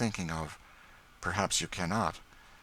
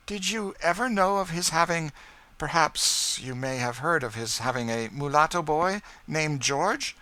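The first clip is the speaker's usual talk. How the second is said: higher, louder, tense questioning; Madame Desault; 9 semitones higher, 5 dB louder than usual. A narrator's reading. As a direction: press the question, holding back hope.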